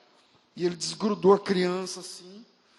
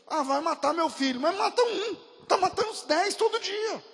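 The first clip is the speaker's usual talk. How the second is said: urgent plea; 13 semitones higher, 5 dB louder than usual. Retold or imitated. Missionary urging tribe to give child.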